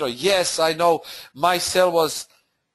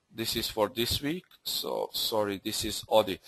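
soft, lower aside while checking; muttered self-correction; 6 semitones lower, 8 dB quieter than usual. Real, not acted. Trader checking his slides mid-webinar, muttered self-correction. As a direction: say it low and soft, half to yourself.

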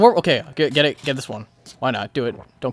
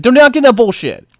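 ordinary talk

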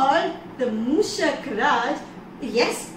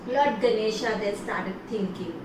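usual speaking voice

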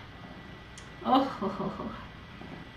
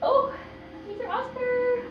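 delighted greeting; 13 semitones higher than usual. Real, not acted.

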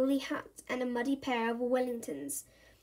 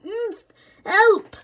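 higher, then a loud yell for help; cry for help; 9 semitones higher, 11 dB louder than usual. Acted. Bertie stuck head first in the bin, yelling. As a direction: shout from inside the bin.

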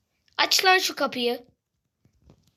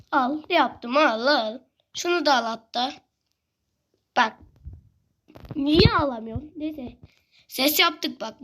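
ordinary delivery

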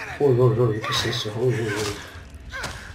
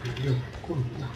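cursing under breath; 9 dB quieter than usual. Real, not acted. Startled, quiet curse.

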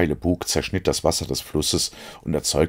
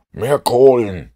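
higher, much louder, slow drawn-out yell; slow shout; 8 semitones higher, 8 dB louder, slower than usual. A narrator's reading. Holmes shouts again into the engine room, slow and loud. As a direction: bellow it down to the stokers. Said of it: "schrie Holmes".

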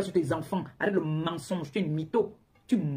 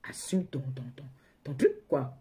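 whispered, slow, half to herself; hushed aside; 6 semitones lower, 5 dB quieter, slower than usual. Real, not acted. Whispering a jab at her lying rival on the live. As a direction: drop to a whisper to needle her.

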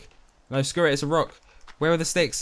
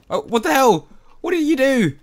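much higher and louder, a shocked yell; startled shout; 13 semitones higher, 9 dB louder than usual. Real, not acted. Streamer yells at the bird that just startled them, real shocked shout. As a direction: yelp in disbelief at what just happened. Bird suddenly attacks or vanishes.

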